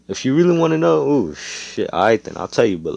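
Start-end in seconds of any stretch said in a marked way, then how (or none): 0.1-1.1 high, loud and fast; excited burst. Acted. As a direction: blurt it out all at once. Ostis bursting out.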